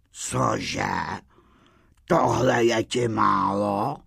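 low, mildly indignant walrus voice; lightly voiced offended old walrus; riled walrus. A narrator's reading. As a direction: take offence with understated outrage. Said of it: "dopálil se mrož".

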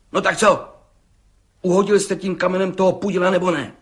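quick, high insistent question; impatient pressing. A narrator's reading. Judge hurrying the stubborn brickmaker. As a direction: rush him for an answer.

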